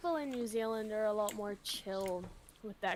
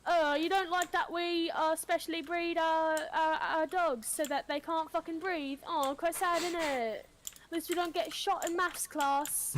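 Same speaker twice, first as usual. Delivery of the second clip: higher, indignant mock British voice; sarcastic British comeback; British accent; 3 semitones higher than usual. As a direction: play an offended Brit. Plays an offended Brit.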